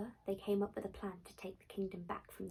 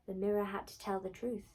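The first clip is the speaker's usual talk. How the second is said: usual speaking voice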